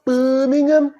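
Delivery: pouting, drawn-out Brummie; Birmingham accent, lips pushed out. Shown as a demonstration. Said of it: "you gotta push your lips out".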